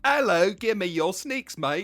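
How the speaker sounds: put-on slow, loud, high Cockney accent; a hammy Cockney thug. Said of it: "keep the Cockney accent thing going".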